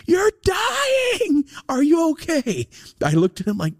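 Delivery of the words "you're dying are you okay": very high, hammed-up wailing shout; mock panic, squealed way up high; doctor's alarm. Doctor panicking over the patient's ear, hammed-up alarm. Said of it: "He said it like".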